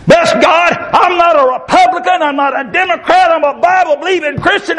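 fiery street preacher shouting at the congregation; preaching at full force. Acted. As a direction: build the sermon into a shouting call.

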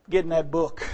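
ordinary talk

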